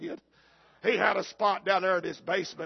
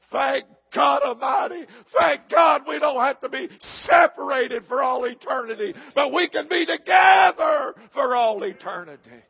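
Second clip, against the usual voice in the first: higher, much louder joyful preaching; shouted praise; 8 semitones higher, 12 dB louder than usual. Real, not acted. Pastor rejoicing with his friends, shouting.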